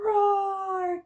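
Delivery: playful mock roar. Shown as a demonstration. Teacher roaring for babies to copy, playful mock roar. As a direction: roar gently, like a game. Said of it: "Ask your baby to roar with you".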